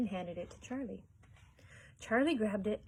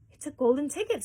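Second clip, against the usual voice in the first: high, loud excited scream of a shopkeeper; 6 semitones higher, 9 dB louder than usual. A narrator's reading. Shopkeeper spots the golden ticket, screaming with joy. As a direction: shriek it out in disbelief. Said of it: "screamed the shopkeeper".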